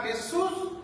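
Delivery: high pitched cry of the name; calling out. Retold or imitated. Blind man crying out to Jesus, high call. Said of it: "empezaba a gritar".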